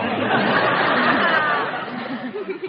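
big high burst of laughter; hearty laugh. Real, not acted. Host cracking up at Benny's quip. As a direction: laugh out loud at the comedian.

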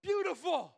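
"Beautiful." high and loud, fully landed; emphatic beautiful. Acted. Preacher revealing the pastor's word to the crowd, emphatic. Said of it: "guess what word he used".

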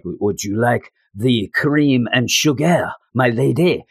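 put-on slow, drawn-out French accent; hammed-up French accent. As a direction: play the suave Frenchman offering coffee, French accent. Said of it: "he's a French guy".

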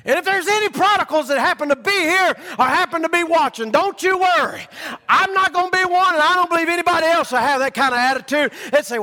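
high, loud crying call of a preacher; full-force preaching. Acted. Preacher assuring prodigals in the crowd, shouting.

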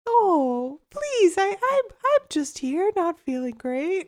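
very high, slow teddy bear voice; mock-pitiful patient fishing for care; hammed-up helpless little plea; Bobby. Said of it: "I'm the wolf and he's the teddy bear".

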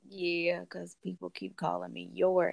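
low, quiet, dry retort; a dry, dead-quiet comeback to the man who mixed up the names; deadpan reply. Retold or imitated. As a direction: say it flat and unimpressed under your breath. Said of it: "And I'm like".

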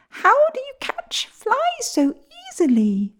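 sparrow voice. Acted. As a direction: chirp it like a small sparrow. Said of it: "asked the sparrow".

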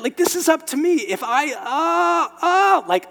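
high, loud stammering panic; comic terrified stammer; panicked baby monkey. Retold or imitated. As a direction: keep squealing as the terrified baby.

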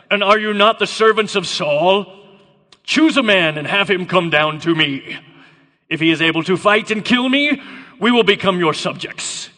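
loud challenge in a put-on Connery-like accent; Goliath's accented bellow. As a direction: proclaim it to the enemy ranks. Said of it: "I don't know why I have this accent".

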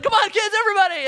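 high, loud rallying call. Retold or imitated. Intern fleeing through the turnstile to his youth group, calling out. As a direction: holler to hurry the group along.

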